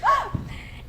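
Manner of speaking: sharp, high gasp; scandalized intake of breath; shocked gasp. Acted. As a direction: gasp in mock horror.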